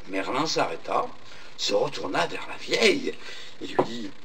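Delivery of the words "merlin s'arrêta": usual speaking voice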